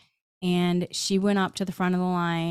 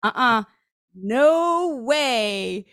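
higher and louder, digging in against the guides; stubborn refusal; 3 semitones higher, 4 dB louder, slower than usual. Retold or imitated. Arguing back at her guides, loud stubborn refusal. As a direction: refuse like a balking kid, rising to no way. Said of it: "I argue with them too".

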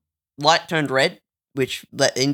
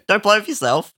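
higher mock scolding; mock stranger; 8 semitones higher than usual. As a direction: jeer it like a nosy stranger. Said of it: "you just go".

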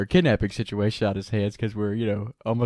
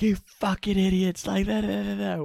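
higher, slowed-down imitation of a furious teammate; mock cussing rant; 11 semitones higher than usual. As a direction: ham up a teammate losing it. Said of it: "he goes on this full, like, cussing tangent".

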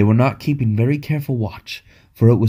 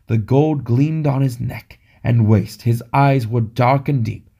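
plain reading voice

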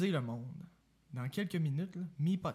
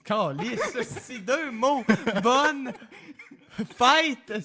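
en riant; 7 semitones higher, 7 dB louder, much slower than usual. Real, not acted. Fou rire en disant bonne fête.

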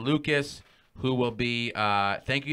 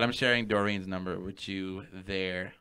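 ordinary delivery

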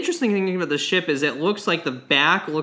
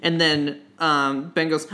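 ordinary talk

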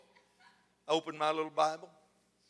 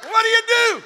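very high, loud comic yell; mock outburst; 14 dB louder than usual. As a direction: shriek the question in mock bewilderment.

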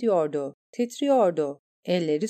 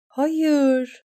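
high, loud anguished cry; screaming no; 7 semitones higher, 4 dB louder than usual. A narrator's reading. A girl screams as the horse stumbles, anguished. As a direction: cry out helplessly. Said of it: "diye bağırıyordu bir kız".

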